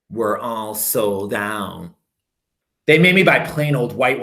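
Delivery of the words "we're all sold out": low, very slow shopkeeper voice; shoe salesman. Acted. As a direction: drawl it like a bored shoe man. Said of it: "the shoe man said".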